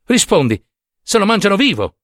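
high, vexed insistence; acted irritated outburst; exasperated demand. A narrator's reading. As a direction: demand an answer crossly. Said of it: "al colmo della stizza".

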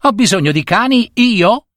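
high, indignant little bully; acted high-voiced outrage; mock child's yell. A narrator's reading. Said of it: "gridava il piccolo prepotente".

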